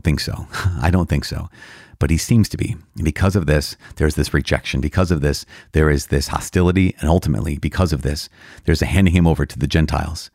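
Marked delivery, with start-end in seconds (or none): none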